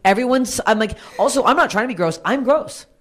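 loud, high, heated rant; fired-up rant. Real, not acted. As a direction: hammer the point home, riled up. Heated rant about dirty comics.

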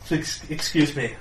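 ordinary delivery

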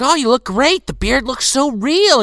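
high, eager fawning praise; gushing crew voice. Retold or imitated. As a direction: gush over him.

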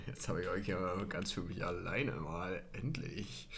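low, soft, sly aside; conspiratorial hush. Acted. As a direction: lean in like sharing a secret. Alone with audience, conspiratorial.

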